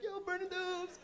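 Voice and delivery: mock high-pitched club party cry; hyped club girl. Girl at club in retold bit.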